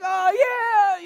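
high, loud mock swoon; hammed-up girly delight; imitated girlish gushing. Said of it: "it's like".

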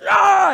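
loud, high hammed-up scream; big reenacted holler of panic; terrified ride scream. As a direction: yell in mock terror on a coaster. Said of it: "you know, like this".

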